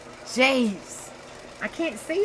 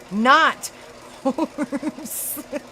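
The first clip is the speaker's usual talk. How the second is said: breaking up mid-phrase, higher; laughing; 4 semitones higher than usual. Real, not acted. Streamer clearing up her accent mix-up, laughing. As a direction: giggle through the word you shouldn't say.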